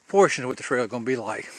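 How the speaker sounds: usual speaking voice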